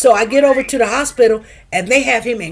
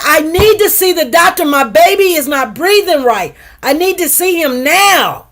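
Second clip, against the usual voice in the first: louder, higher, insistent plea; urgent retold demand; 4 semitones higher, 4 dB louder than usual. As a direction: demand help for your baby right away. Retells urgent demand for doctor.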